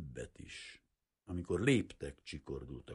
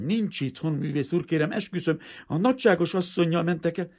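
frightened pleading; 12 semitones higher, 11 dB louder than usual. A narrator's reading. Frightened maid swears.

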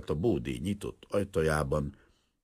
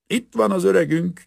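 put-on high, loud cheerful drunk yell; loud tipsy plumber calling out; drunken shout; 10 dB louder than usual. A narrator's reading. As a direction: bellow a greeting into the hut. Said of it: "kiáltotta az egyik vízvezetékszerelő".

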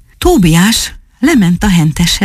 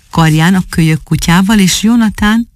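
plain reading voice

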